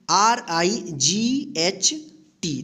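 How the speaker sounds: letters spelled out slowly; slow spelling. Shown as a demonstration. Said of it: "इसको क्या पढ़ते हैं?". (धीमी स्पेलिंग)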